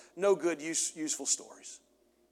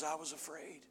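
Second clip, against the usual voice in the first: timid excuse; 14 dB quieter than usual. Acted. Unfaithful servant's excuse.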